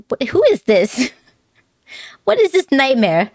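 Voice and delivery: playful mock dismay; horrified at own voice. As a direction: recoil at hearing yourself. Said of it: "I'm like".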